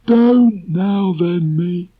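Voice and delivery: high, slow gloomy donkey; Eeyore voice. Acted. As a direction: drag it out like a glum donkey.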